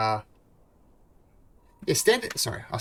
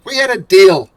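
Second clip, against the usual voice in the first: loud, high barking boss dwarf; gruff dwarf shout; 8 semitones higher, 14 dB louder than usual. Acted. Dwarf foreman yells at the party to start fishing. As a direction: bellow orders at lazy workers.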